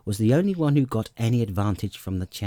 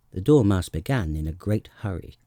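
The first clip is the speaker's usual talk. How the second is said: ordinary delivery, nothing marked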